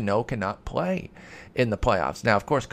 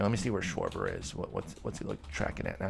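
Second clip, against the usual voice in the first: muttering to himself; 9 semitones lower, 10 dB quieter than usual. Real, not acted. Muttering while looking it up.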